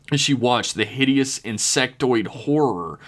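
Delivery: usual speaking voice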